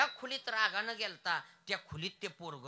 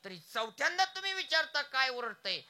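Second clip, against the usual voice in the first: higher, louder angry outburst at his father; angry son; 6 semitones higher, 7 dB louder than usual. Acted. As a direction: snap at the old man, fed up. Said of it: "आणि त्या मुलाला राग आला".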